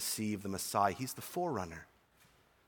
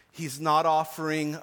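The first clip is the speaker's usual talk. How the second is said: ordinary delivery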